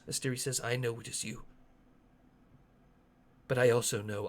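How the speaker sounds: lightly voiced leader, sinking to soft resolve; resigned resolve in character. Asteri tells Chotuku he must die, grave and quiet.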